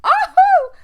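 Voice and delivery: sudden high shriek; scream. Real, not acted. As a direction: shriek it out, startled. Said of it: "Sorry for the screaming".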